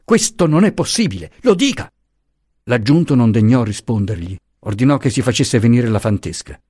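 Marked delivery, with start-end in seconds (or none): 0.0-1.9 high, rising outraged protest; cry of protest. A narrator's reading. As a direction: cry out against the arrest, climbing higher. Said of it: "mise un grido di protesta".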